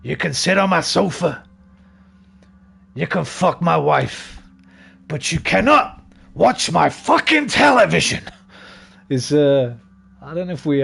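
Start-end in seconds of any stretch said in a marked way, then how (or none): 0.0-8.2 slow, building Pacino rant; Pacino impression. Imitating Pacino's slow rant, rising to a loud high finish. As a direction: lay down the law like Pacino. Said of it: "he does a lot of that kind of stuff".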